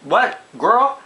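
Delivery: can't-believe-my-ears sass; high, disbelieving, put-on sassy voice; incredulous. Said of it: "And I'm like".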